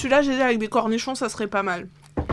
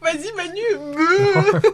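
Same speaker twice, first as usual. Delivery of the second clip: silly voice; 7 semitones higher, 6 dB louder, much slower than usual. Pretend. Silly mock cow voice.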